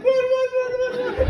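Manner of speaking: high rapid excited whats; eager girlfriends. Retold or imitated. Girlfriends rushing back to the table, high eager voices. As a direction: squeal like curious girlfriends. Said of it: "they're like".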